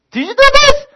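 high, loud, pointed question; angry demand. Retold or imitated. Dad asking the next kid who did it, shouting. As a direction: push the kid for a confession.

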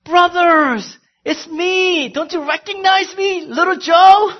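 high, loud joyful shout of reunion; high shouted "little Joe" in a hammed-up reunion; Joseph calling out. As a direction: cry out to the brothers, voice way up. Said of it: "why doesn't he go".

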